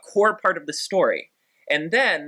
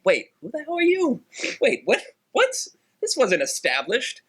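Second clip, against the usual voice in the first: high, bewildered mock outburst; mock confusion; 9 semitones higher than usual. Mocking the Lion King two characters' confusion, high and bewildered. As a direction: squeak out the confusion like a baffled lion. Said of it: "And it's like".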